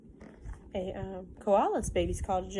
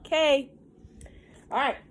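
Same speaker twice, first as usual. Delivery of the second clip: ordinary delivery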